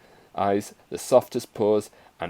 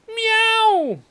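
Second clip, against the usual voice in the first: piercing, loud, very high meow; yowling kitten meow; 7 dB louder than usual. A narrator's reading. Kitten shot up into the air, high yowl. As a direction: yowl like a kitten launched skyward.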